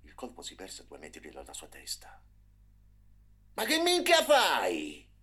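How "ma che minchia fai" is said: high, loud, slow furious growl; angry snarl. A narrator's reading. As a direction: snarl at the boy in the way. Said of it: "ringhiò il manovratore".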